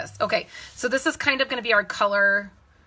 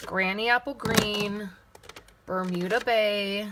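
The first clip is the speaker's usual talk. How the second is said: normal talking voice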